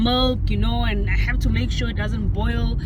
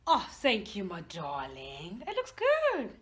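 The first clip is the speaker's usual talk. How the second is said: sweet mother; 3 semitones lower, 12 dB quieter, slower than usual. Acted. Mother accepting tea sweetly.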